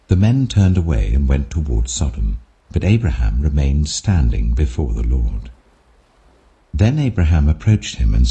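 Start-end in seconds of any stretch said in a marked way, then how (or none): none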